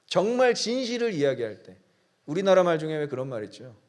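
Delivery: ordinary talk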